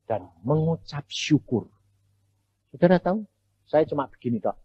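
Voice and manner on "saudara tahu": ordinary talk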